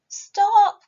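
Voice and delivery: high, loud shout of Stop!; cry out. Acted. Ellen shouts at Mirabell to stop the shaving cream, loud. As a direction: shout to halt her right now. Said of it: "cried Ellen".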